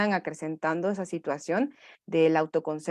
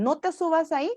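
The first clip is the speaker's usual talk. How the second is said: parent's warning pitched much higher; high nagging parent; 9 semitones higher than usual. Shown as a demonstration. Mocking the fussy parent, high nagging warning. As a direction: nag it up high like a fussing parent. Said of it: "Evitemos el".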